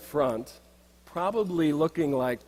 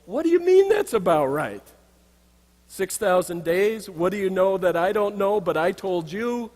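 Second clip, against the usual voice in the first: higher, louder incredulous protest; baffled outburst; 5 semitones higher, 4 dB louder than usual. Retold or imitated. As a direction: blurt it out in disbelief. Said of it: "I go".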